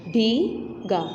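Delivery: भीगा drawn out slowly, the long ई held; stretched demo word. Shown as a demonstration. Teacher to pupils, slow sounded-out भीगा. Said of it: "इसमें भी ई की आवाज़ आ रही है".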